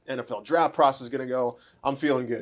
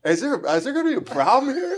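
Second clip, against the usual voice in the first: high, half-laughing mock alarm; laughing suspicion; 11 semitones higher, 3 dB louder than usual. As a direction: ask it through a laugh, comically wary. Acted quote, sizing-up suspicion.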